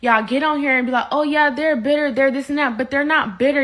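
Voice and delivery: ordinary delivery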